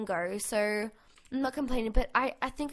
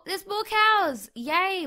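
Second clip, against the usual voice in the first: higher, louder, drawn-out delight; excited shout; 7 semitones higher, 8 dB louder, much slower than usual. Real, not acted. Spotting more cows to farm, excited high shout. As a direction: shout it with glee.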